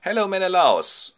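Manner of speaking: high, loud lad's hello; boyish greeting. Acted. Telemachos arrives at the king's house, high boyish greeting. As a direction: greet the king in a high boy's voice. Son greets the king, acted.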